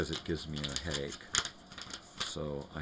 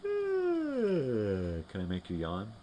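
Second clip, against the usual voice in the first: high, very slow, louder yawny drawl; slow yawning voice; 6 semitones higher, 4 dB louder, much slower than usual. Real, not acted. Owner teasing the dog into yawning, slow high yawny voice. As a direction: stretch it out like a yawn.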